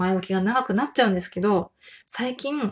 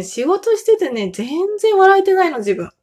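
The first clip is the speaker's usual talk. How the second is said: blurted casual; 8 semitones higher, 5 dB louder than usual. Real, not acted. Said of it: "思わずタメ口になっちゃいました".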